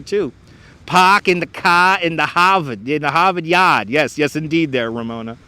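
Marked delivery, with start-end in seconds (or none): 0.8-3.9 Boston accent. Shown as a demonstration. Streamer showing off accents to viewers, Boston accent. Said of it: "almost like Boston. Boston's kinda similar there".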